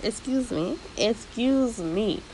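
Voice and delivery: usual speaking voice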